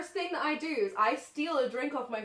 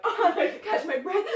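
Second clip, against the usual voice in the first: high, louder, laughing through it; mock sobbing breathless; 7 semitones higher, 6 dB louder than usual. Drunk Rachel crying outside the club, mock breathless. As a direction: play the breathless weeping for laughs.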